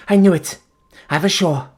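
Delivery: high, loud cry of triumph and pain; exulting cry. A narrator's reading. Fiancée hearing her name, high loud exulting cry. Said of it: "exulting and terrible cry".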